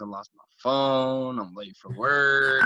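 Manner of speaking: slow, drawn-out moping; dragging self-pity, words stretched; glum contemplation. Retold or imitated. Sitting at work contemplating everything, glum. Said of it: "I'm contemplating everything".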